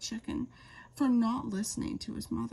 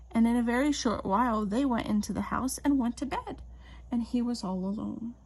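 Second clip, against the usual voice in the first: ordinary delivery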